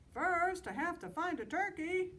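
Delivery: very high, loud bellowed reply; bellowed back. Acted. Mister Moose bellowing back at Sheep, high and loud. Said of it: "bellowed back".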